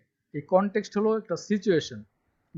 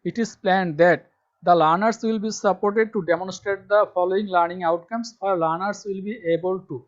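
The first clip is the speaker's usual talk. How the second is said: slow dictation reading; 3 semitones higher, much slower than usual. Shown as a demonstration. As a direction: spell it out slowly for students to copy. Reading syllabus text aloud. (ধীরে ধীরে জোরে পড়ে শোনানো)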